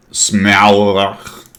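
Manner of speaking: fake French accent. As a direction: try a bad French pronunciation. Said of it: "your French is fantastic".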